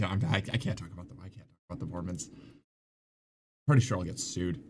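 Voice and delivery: quick, low, hushed retreat; backing off quietly. Real, not acted. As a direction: drop the voice and hurry away from the topic. Backs away from a risky topic.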